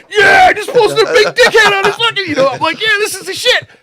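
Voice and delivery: hammed-up giddy gloating; gleeful gloat. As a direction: crow about the prank in a silly high voice. Mimics own gloating self.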